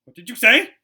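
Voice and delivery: high, loud sharp snap; snapped. Acted. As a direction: bite the question off. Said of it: "his aunt snapped".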